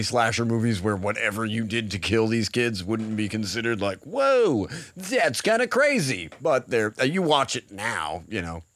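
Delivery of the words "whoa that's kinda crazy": mock amazed reaction. Voices audience amazed by novel kill.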